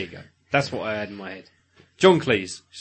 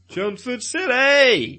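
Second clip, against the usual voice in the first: mock proclamation; 9 semitones higher, much slower than usual. Proclaiming their home town.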